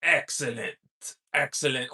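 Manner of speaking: a lightly voiced scheming old boss; Mr. Burns excellent. Lightly voicing Mr. Burns for the chat. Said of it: "I like when he says".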